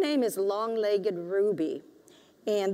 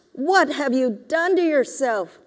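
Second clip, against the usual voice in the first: higher, louder aghast auntie; dismayed auntie; 5 semitones higher, 5 dB louder than usual. A narrator's reading. Auntie aghast at her nephew's hair, higher and louder. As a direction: cry out in dismay at the boy.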